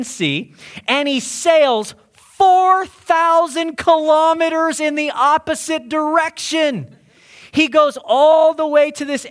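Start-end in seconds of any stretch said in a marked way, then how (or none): 2.1-7.0 FOUR THOUSAND KILOMETERS held high and drawn out; "four thousand kilometers" called out high and slow; incredulous emphasis. Real, not acted. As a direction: hammer "four thousand kilometers" in a raised, sustained voice. Exclaims how far Jonah fled.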